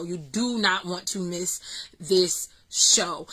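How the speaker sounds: drawn-out, low-key insistence; slow emphatic warning. Real, not acted. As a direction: spell it out word by word, slowly.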